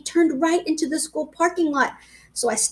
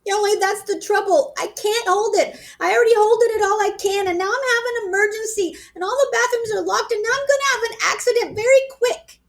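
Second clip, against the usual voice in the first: crying; 9 semitones higher than usual. A narrator's reading. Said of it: "I started to cry".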